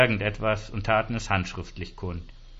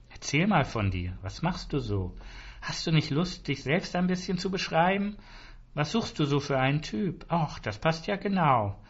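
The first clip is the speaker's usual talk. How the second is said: higher, coaxing chat-line voice; flirty phone woman; 6 semitones higher than usual. A narrator's reading. Sauna club woman chatting up Arno on the phone, flirty and higher. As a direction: coax him in a higher, flirty lilt.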